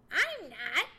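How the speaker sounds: loud, quick comeback; sharp retort. A narrator's reading. Squirrel disagreeing with Johnny, retorting. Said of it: "retorted Chatterer".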